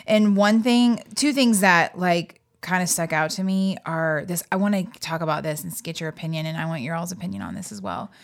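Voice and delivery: usual speaking voice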